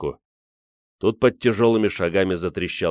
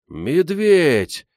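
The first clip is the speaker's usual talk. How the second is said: put-on high, loud boyish cry; excited shout; 9 semitones higher, 5 dB louder than usual. A narrator's reading. Гриша spots a bear through the window, shouting. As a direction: cry out the discovery. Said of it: "закричал Гриша".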